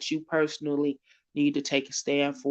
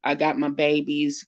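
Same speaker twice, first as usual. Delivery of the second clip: normal talking voice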